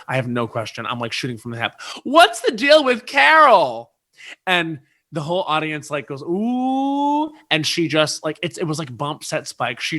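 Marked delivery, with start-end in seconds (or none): 2.0-3.9 high, loud, stretched-out blurt; shouted drunken question. Retold or imitated. Re-enacts drunken question at mic. 6.2-7.3 high, long rising "ooh"; audience "ooh". Retold or imitated. Imitates the whole audience.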